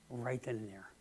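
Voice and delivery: ordinary talk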